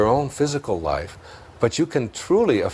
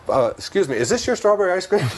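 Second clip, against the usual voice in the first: laughing quote; 8 semitones higher than usual. Pretend. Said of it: "someone walks by and says".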